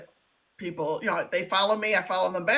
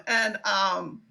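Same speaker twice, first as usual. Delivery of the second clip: ordinary talk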